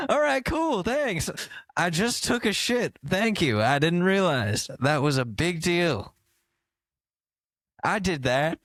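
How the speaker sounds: high, bewildered mock Biden voice; hammed-up Biden pleased with himself; confused old Biden. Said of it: "He's like".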